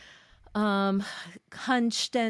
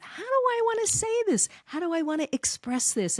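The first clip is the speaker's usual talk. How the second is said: mock inner question; 11 semitones higher than usual. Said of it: "you're thinking about".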